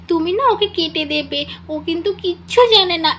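put-on high, chirpy child voice showing off to her new tutor; a small chatterbox girl bragging, sing-song and eager; little girl voice. Little Rimi boasting to her tutor, in a child's voice.

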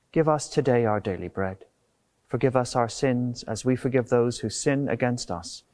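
ordinary delivery

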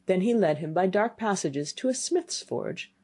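usual speaking voice

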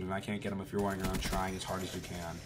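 normal talking voice

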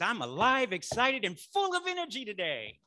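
loud, high, energetic declaration; excited affirmation. Shown as a demonstration. Preacher shows what they tell real estate students, loud and excited. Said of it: "I'm getting excited up here".

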